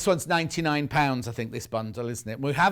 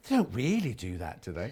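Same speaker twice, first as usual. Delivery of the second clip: doubtful friends quoted; 7 semitones lower, 6 dB quieter than usual. Retold or imitated. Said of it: "People are like".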